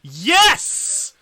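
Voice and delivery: loud joyful yes; excited cheer. Real, not acted. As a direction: cheer the win. Cheering the wheel result.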